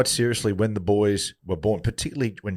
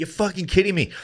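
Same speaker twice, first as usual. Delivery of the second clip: retold disbelief; 8 semitones higher than usual. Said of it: "He went".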